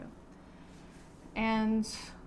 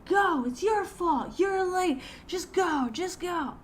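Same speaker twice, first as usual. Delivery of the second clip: mock yelling man; 9 semitones higher, 4 dB louder, slower than usual. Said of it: "he could hear the guy saying".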